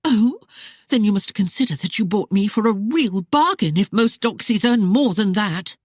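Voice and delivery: high, teasing working-class London voice; coy Cockney taunt. Acted. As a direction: play the saucy doxy mocking him.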